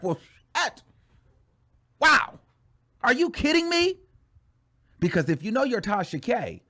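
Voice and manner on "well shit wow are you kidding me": imitating a stunned rival, pitch jumping higher; mock disbelief. Tasha K seeing the views, mock stunned disbelief. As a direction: act floored by the news, higher on the wow. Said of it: "she's like".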